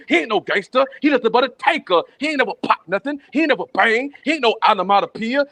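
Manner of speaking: usual speaking voice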